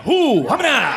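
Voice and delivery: high, loud nonsense exclamation; hammed-up loud hoo hamina; loud mock gibberish. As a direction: blurt fake gibberish to dodge jury duty. Comic imitating the trick.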